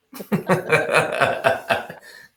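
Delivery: big, loud belly laugh. Real, not acted. Storyteller cracking up at his punchline, loud laugh. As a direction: burst out laughing.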